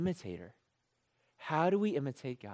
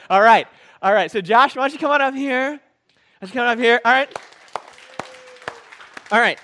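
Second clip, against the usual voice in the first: loud, higher call to come up; loud, high, excited welcome; 7 semitones higher, 12 dB louder, faster than usual. Real, not acted. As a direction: summon him to the stage loudly. Calling volunteer up to stage.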